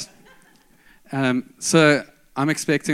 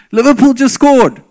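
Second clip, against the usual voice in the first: loud excited call from afar; dad shouting; 8 semitones higher, 15 dB louder than usual. Retold or imitated. Dad calling out the football score, loud and excited. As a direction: shout the score across the yard.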